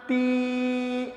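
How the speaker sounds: تی with a long, held ee; drawn-out vowel. Shown as a demonstration. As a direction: stretch the ee of تی steadily. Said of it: "تا کو کھینچ کر پڑھیں گے". (تی کو کھینچ کر)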